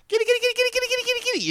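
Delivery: very high creepy kitty chant; creepy sing-song call. Retold or imitated. Repeating the movie's call.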